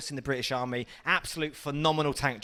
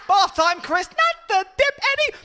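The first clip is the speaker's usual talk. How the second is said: very high, loud, drawn-out screech; mock film villain; 7 dB louder than usual. Comic acting out bathtime as Roger Rabbit's villain, manic high shriek. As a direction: screech it like a crazed cartoon villain.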